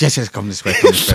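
laughing Italian waiter voice; Italian accent. Retold or imitated.

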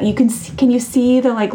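ordinary delivery

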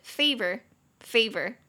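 slow American "favor" ending on an r; American accent. Shown as a demonstration. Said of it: "美式就是". (美式发音)

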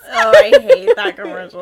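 squealing with laughter; laughing groan. Real, not acted. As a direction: laugh out the complaint. Groaning at a hated commercial.

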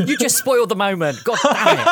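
high, loud laughing complaint; laughing exasperation. Real, not acted. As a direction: laugh while scolding the player. GM laughing at spoiled moment.

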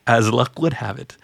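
ordinary talk

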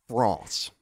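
France said the French way, nasal vowel and back R; France in French, not English; French pronunciation. Shown as a demonstration. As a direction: say France like a Parisian, frɑ̃s. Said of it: "That's how it's actually said".

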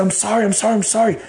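mild re-enacted desperate apology; retold scream. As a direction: plead high, over and over. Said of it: "I screamed".

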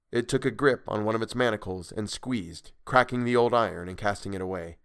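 ordinary delivery

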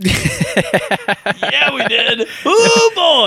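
high-pitched, giggly agreement; real amused squeak, rising at the end; laughing recollection. Real, not acted. Laughing reaction to rough game session.

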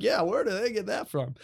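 playing along with mom, faking surprise; mocking echo. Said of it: "I'm like".

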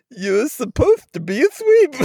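laughing mimic of a mom scolding; mocking mom voice. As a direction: tease her by copying her dog voice. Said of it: "Listen to the way you sound".

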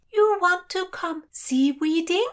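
high call, rising at the end; called question. A narrator's reading. Lady on deck mishears the heroine's request, calling down. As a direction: call it down, puzzled. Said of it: "called Brigitta".

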